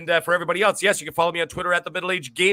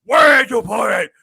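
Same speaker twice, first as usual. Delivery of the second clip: Batman impression; 5 semitones higher, 7 dB louder, faster than usual. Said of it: "He's like".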